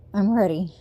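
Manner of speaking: usual speaking voice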